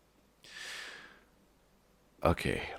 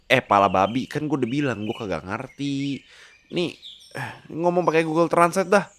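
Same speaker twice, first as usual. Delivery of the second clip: higher, louder brash slang; cocky pig Lipin; 9 semitones higher, 4 dB louder than usual. Acted. Lipin the pig hollering at Marco on the road. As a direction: talk like a cheeky street-smart pig. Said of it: "Lipin speaks again".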